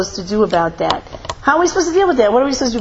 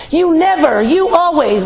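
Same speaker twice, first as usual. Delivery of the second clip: accusing spouse; 7 semitones higher, 3 dB louder, slower than usual. Retold or imitated. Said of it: "says something hurtful to us".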